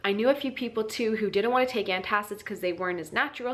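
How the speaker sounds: normal talking voice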